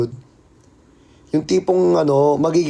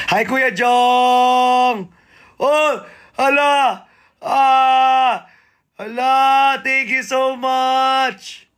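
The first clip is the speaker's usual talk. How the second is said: high, drawn-out delighted thanks; excited squeal; 6 semitones higher, much slower than usual. Real, not acted. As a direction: squeal it out high, stretching each word. Squeals thanks to arriving viewer.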